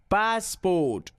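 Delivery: passport split into two stretched syllables; pronunciation drill. Shown as a demonstration. Trainer leading pronunciation exercise, passport drawn out and exact. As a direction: stretch both halves of passport.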